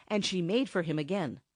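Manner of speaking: ordinary delivery, nothing marked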